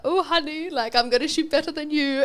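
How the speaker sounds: teasing couple. Retold or imitated. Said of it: "And they're like".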